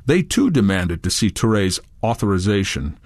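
usual speaking voice

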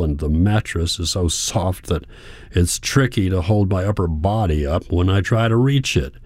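ordinary delivery

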